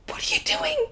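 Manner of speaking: very high-pitched mock alarm; imitated frantic demand. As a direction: squeal it like a startled partner. Retold panicked question from partner.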